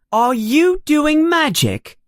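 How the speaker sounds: high, pushy demanding question; bossy girl. A narrator's reading. Girl bursts in asking new classmates about a frog, bossy and high. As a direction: take charge of the room like a know-it-all. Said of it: "a very bossy voice".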